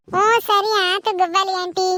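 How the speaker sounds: put-on slow, high child voice; drawn-out childlike agreement; obedient child. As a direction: answer aunty like a good child.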